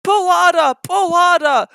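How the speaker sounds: high, loud straining call; puffing little engine. Acted. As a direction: urge the big engine on. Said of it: "puffed Thomas to Gordon".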